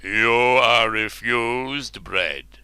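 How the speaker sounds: very slow, grave king's verdict; stern refusal. Acted. Ubar refusing the hero bread, very slow and grave. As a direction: pronounce it like a sentence. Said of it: "said Marlenus".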